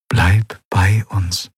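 slow, tender mother's plea. Acted. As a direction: ask her gently to stay, slowly. Mother asks her to stay. (langsam und zärtlich bittend)

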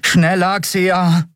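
high, pressing call; urgent urging. A narrator's reading. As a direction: urge the pilot to hurry. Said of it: "drängte Miko".

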